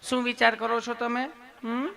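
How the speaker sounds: put-on coaxing nurse voice; sweet, loving nurse. Nurse asking the patient his thoughts, sweetly. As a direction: ask lovingly with a little hum after. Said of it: "તમે કેટલું પ્રેમથી પૂછો છો".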